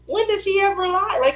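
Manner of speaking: ordinary talk, nothing marked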